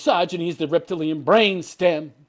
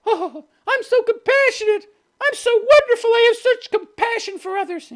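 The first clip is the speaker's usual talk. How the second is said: high, far-off sneering voice of a self-praising liberal; mocking smug liberal; 4 dB louder than usual. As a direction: ham up a preening do-gooder, much higher. Mocking leftists as compassionate.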